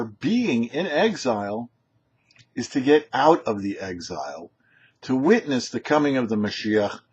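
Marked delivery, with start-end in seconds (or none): none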